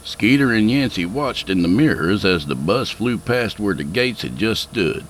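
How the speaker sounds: usual speaking voice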